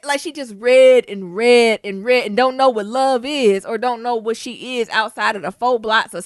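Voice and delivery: ordinary talk, nothing marked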